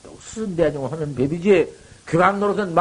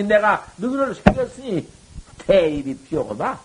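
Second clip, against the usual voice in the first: usual speaking voice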